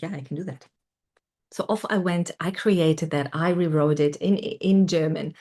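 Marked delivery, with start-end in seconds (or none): 0.0-0.7 softly, calm self-assurance; quiet inner voice. Retold or imitated. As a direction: murmur it to yourself with quiet certainty.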